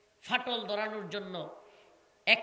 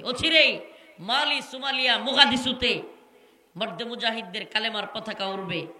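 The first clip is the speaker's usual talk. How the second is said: fiery preaching; 7 dB louder than usual. Acted. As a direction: thunder the promise at the crowd.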